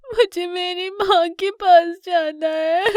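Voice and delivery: put-on high, slow, wailing child voice; crying cub. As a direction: wail for Ma like a lost little one. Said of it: "कियोन बहुत रोता है". (रोते बच्चे की ऊँची, खिंची आवाज़)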